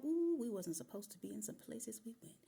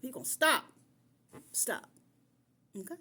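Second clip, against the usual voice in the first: louder, firm telling-off; stern command; 5 semitones higher, 8 dB louder than usual. Real, not acted. Woman scolding her past boyfriend, stern and loud. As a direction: lay down the law, short and hard.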